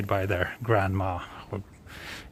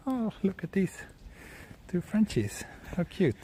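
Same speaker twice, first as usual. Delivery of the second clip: cooing at dogs; 8 semitones higher, 7 dB quieter than usual. Real, not acted. Cooing at two passing French bulldogs.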